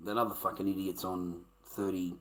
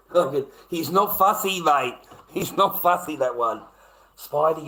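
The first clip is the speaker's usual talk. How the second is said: loud, high, laughing rant; loud amused rant; laughing loud outburst; 7 semitones higher, 9 dB louder than usual. Real, not acted. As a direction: crack up and get loud about it. Laughing, loud about Spidey.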